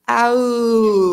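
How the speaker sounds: small tentative howl; timid howl. Acted. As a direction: try a shy howl. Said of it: "Get into it".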